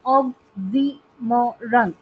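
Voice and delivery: oxymoron very slowly as ox-y-mo-ron; syllable by syllable. Shown as a demonstration. Teacher showing students a four-syllable example, syllable by syllable. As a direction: break oxymoron into four separate beats. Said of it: "example के लिए देखते हैं".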